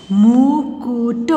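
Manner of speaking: मुकुट very slow, sound by sound; syllable by syllable. Shown as a demonstration. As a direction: stretch मुकुट into held syllables. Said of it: "sound pronounce करके देख लीजिए". (मुकुट को तोड़-तोड़ कर, धीरे)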